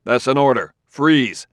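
shouted freeze; urgent command. A narrator's reading. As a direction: snap out the freeze order. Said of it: "cried Bailey".

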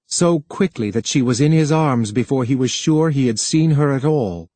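usual speaking voice

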